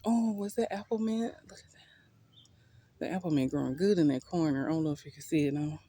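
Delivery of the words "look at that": slow breathy whisper. Real, not acted. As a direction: hush it to yourself. Whispered, very quiet.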